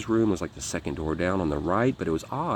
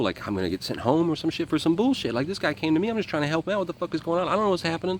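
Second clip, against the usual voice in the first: high-pitched, mounting fretting; reenacted panicky protest; retold worry; 9 semitones higher, faster than usual. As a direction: let the pitch climb as the worry spirals. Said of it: "I'm worried that I'm gonna".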